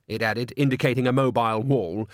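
ordinary delivery, nothing marked

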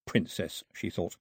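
usual speaking voice

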